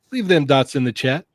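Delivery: ordinary talk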